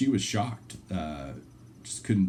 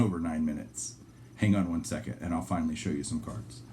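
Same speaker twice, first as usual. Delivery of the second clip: usual speaking voice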